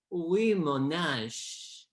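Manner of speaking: "oui, mon ange" drawn out slowly, French-sounding; local pronunciation. Shown as a demonstration. Dialect coach claiming how locals say Wyoming, slow French-style "oui, mon ange". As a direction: stretch "oui, mon ange" like a mock-French place name. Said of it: "Real locals pronounce it".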